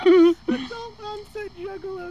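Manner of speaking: high, slow dramatic repeat; mock announcement. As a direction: announce it like a title.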